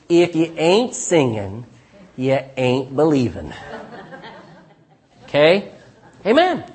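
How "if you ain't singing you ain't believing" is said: slow, drawn-out Texan accent with a chuckle. Shown as a demonstration. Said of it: "I'll say it in Texan".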